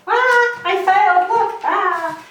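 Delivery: high, loud startled yelp; startled cry. Real, not acted. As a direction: yelp as the ball drops. Drops the ball, cries out.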